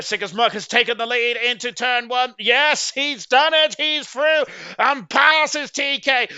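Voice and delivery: excited commentary. Real, not acted. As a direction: cheer the pass as it happens. Lead change called live.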